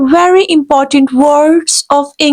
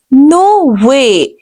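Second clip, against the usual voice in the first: usual speaking voice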